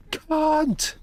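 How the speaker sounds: high-pitched yelp of a curse; Jill scream. Acted. Streamer doing their rendition of Jill leaving a room, screamed high. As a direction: yell it high, for the whole mansion to hear.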